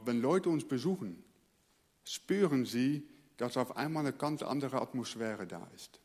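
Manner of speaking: normal talking voice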